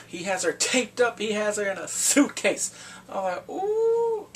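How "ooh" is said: very high, loud "ooh"; outraged "ooh". Real, not acted. Viewer hearing she's in a suitcase, high outraged ooh. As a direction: let out a shrieky high ooh. Reacting to child taped in suitcase.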